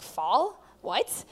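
baffled, questioning echo; confused. Retold or imitated. Student retells hearing thoughts in her head, confused. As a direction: re-enact a puzzled double-take, voice rising. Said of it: "I'm really confused".

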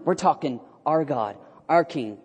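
normal talking voice

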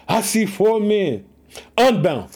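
ordinary talk

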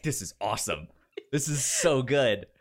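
ordinary talk

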